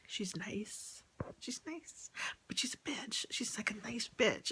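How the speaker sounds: whispered gossip, half-laughing at it; confiding whisper. Real, not acted. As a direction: let it slip like a secret. Gossiping about relative, confiding.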